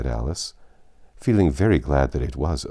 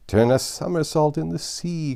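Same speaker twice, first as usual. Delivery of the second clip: cried out; 12 semitones higher than usual. Acted. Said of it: "cried the Mock Turtle".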